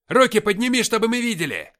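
very loud, high-pitched soldier's command; резкий окрик. A narrator's reading. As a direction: bellow an order at a suspect. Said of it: "рявкнул ближайший". (громкий рявкающий приказ)

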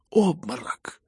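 high, loud cry of alarm; startled shout. A narrator's reading. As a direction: call it out sharply to the whole room. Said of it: "закричали кругом".

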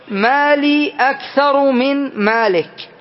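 ما لي أكثر من مالك read slowly and evenly; slow repeat. Shown as a demonstration. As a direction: spell the phrase out slowly for students. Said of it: "نعيد".

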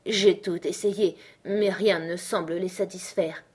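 plain reading voice

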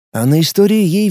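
ordinary delivery

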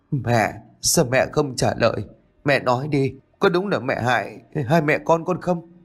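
distressed questioning. A narrator's reading. Con gái run rẩy chất vấn mẹ. (giọng chất vấn, khẩn khoản)

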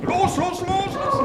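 high, eager calls of los; urging on. Acted. Director urges pupils forward, high and eager. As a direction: spur the pupils on like a field officer.

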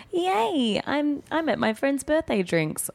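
very high, squealy mock cheer; hammed-up giddy voice, high and drawn-out; mock excitement. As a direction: gush like a cheesy social media post. Mocking own gushing Instagram posts.

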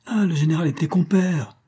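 high-pitched accusing cry; exclaiming. A narrator's reading. Several guests accuse the general of being an accomplice, exclaiming. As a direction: call it out as one of a crowd. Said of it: "plusieurs voix s'écrièrent".

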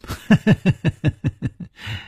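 long, high, rapid cackle; villain's cackle. A narrator's reading. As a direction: cackle high, falling at the end. Villain laughs back at the taunt.

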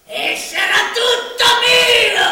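loud boast. Acted. Master boasting to his servant, shouting in triumph.

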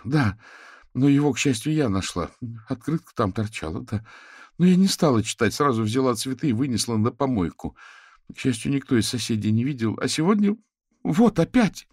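put-on a high, distraught young woman pleading; upset young wife. A narrator's reading. Katerina tells the investigators about the bouquets, distraught. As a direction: tumble it out close to tears.

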